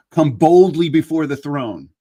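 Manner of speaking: loud, slow, ringing proclamation of the verse; bold proclamation. Shown as a demonstration. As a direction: declare it like a summons from the pulpit. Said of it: "And what does it say?".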